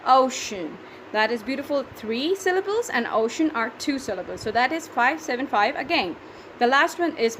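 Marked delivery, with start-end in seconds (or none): none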